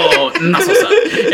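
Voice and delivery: squeaky, laughing echo of the joke; laughing repeat. Real, not acted. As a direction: repeat it through high laughter. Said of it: "laughing repeat of the joke".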